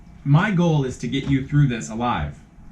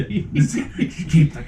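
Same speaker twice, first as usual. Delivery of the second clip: Little Nicky voice; 5 semitones higher, slower than usual. Acted. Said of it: "he's Little Nicky from now on".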